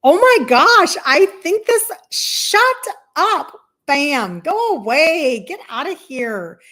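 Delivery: a hammed-up panicked burst, slowing on "go away"; frantic inner voice. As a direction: yell at the lies in your head to get out. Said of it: "I'm like".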